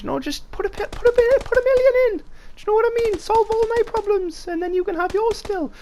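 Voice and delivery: very high squeaky joke voice, fast in the middle; silly high voice. Pretend. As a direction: beg for a million in a cartoon squeak. Joking plea for Patreon money.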